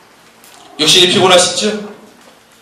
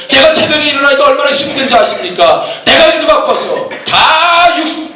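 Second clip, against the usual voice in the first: high, sustained pulpit delivery; insistent, driving exhortation; impassioned preaching; 8 semitones higher than usual. Real, not acted. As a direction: preach urgently without letting the pitch drop.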